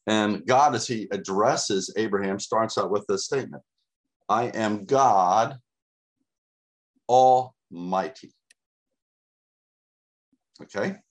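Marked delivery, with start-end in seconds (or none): 4.3-8.3 each word slow and weighty; slow, weighty God Almighty; slow solemn declaration. Acted. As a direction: proclaim it slowly as God. Preacher voicing God's declaration.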